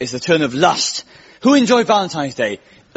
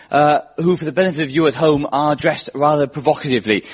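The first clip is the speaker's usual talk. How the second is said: normal talking voice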